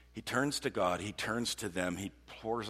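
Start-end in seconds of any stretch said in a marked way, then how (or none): none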